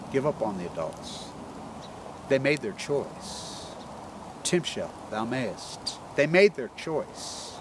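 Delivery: low, soft, slow pronouncement; lightly voiced dream whale, grave and slow; hushed, drawn-out verdict from the whale; solemn whale. Whale's solemn message in retold dream.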